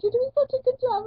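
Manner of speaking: high, loud mock-cheery praise; squeaky imitation of the piercer's encouragement; imitating the piercer. Piercer cheering on the customer, in high mock-cheery praise.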